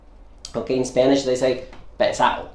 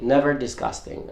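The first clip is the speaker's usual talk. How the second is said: ordinary talk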